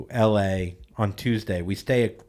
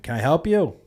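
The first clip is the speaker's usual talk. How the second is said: higher, louder challenge to a stranger; confrontational challenge; 7 semitones higher, 5 dB louder than usual. Retold or imitated. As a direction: call it out like a pointed challenge. Challenging strangers from car window.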